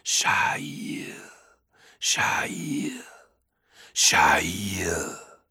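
repeating "Sha'il" as an airy, voiceless wind whisper; gusting windy whisper. Acted. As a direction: breathe "Sha'il" like wind through ruins. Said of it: "whispered the wind".

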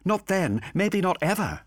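Olivia's voice. A narrator's reading.

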